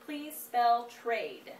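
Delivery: slow careful spelling dictation. Shown as a demonstration. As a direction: hand the child the word slowly. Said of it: "So next word, Bianca".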